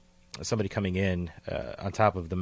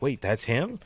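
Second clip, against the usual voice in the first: higher, rising surprised question; retold surprise; 5 semitones higher, 3 dB louder than usual. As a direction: act out a mild double take. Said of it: "he got kinda surprised and was like".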